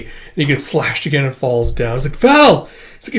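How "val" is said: high, loud call of a name; acted horrified shout; alarmed cry. Crying out to wounded man.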